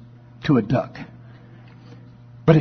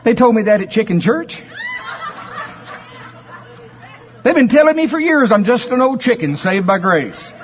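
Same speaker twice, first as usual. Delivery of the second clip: higher, laughing and earnest; naive chick, laughing; 8 semitones higher than usual. Acted. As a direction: tell it innocently through laughs. Chuck explains Chicken Church, laughing.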